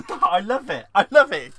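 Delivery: loud, laughing delight; overexcited. Real, not acted. Gushing to Michelle about her word, loud and laughing. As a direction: burst out with glee. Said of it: "I get overexcited".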